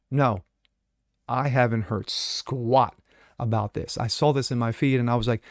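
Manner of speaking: ordinary talk, nothing marked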